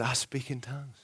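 hushed, voiceless confession; breathy secret admission, barely voiced; whispered confession. Confessing his tongues.

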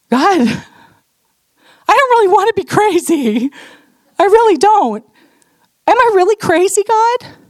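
high, slow, tearful plea to God; tearful pleading. Retold or imitated. Woman on her bedroom floor begging God, tearful and high. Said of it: "I'm just weeping".

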